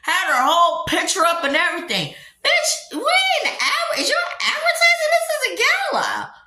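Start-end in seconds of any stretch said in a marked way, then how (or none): 2.4-6.3 high-pitched laughing outburst, very high; laughing mock shriek. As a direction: shriek it through laughter at the absurdity.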